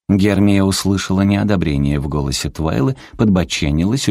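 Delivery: ordinary delivery, nothing marked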